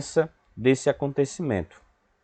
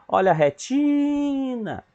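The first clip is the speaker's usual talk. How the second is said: high, long drawn-out TI in "retina"; street vendor call; 12 semitones higher, slower than usual. Shown as a demonstration. Teacher showing pupils the stressed syllable, stretched vendor call. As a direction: cry it out like a hawker. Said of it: "faz o vendedor".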